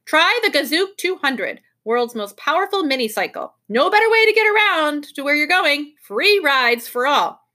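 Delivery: announcer-style hard sell; proclaiming. A narrator's reading. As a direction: proclaim it like a showy sales sign. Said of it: "A large sign near the bikes proclaimed".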